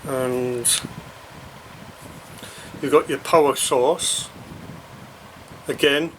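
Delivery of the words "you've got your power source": ordinary talk, nothing marked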